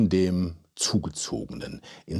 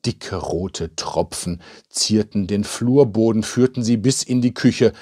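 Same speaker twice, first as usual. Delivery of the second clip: plain reading voice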